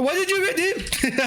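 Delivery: high, exaggerated Indian-accented yell; mock Indian accent. Streamer quoting the Indian scam-baiter yelling at a scammer, hammed-up Indian accent. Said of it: "Sorry, the Indian dude".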